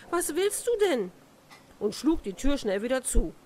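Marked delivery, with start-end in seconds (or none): 0.1-1.1 high-pitched, startled question; calling out. A narrator's reading. Princess to the frog at the door, calling out. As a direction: narrate her startled cry, pitched up high. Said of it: "rief sie da laut".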